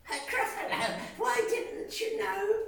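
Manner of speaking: high mouse voice with a chuckle; sly little mouse. Acted. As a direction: feign surprise, laughing. Mouse scaring snake.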